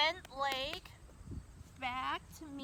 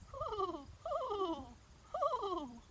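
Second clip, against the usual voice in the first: bird call; 6 semitones higher, much slower than usual. Pretend. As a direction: coo like a bird for the kids. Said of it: "And they say".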